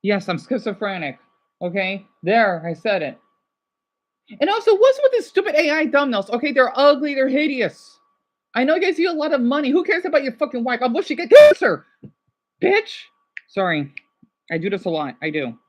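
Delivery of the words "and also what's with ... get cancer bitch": heated, high-pitched tirade; angry rant. Real, not acted. Streamer lashing out over thumbnails, angry. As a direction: rant with a raised voice, building to a loud burst.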